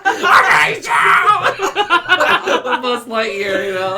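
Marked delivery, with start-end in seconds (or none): 0.0-2.8 very slow, high, laughing shout; mock hateful shout. Speaker mock-quoting a movie villain, slow high laughing shout. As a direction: drag out a hammed-up scream of hate. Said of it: "Lotso going".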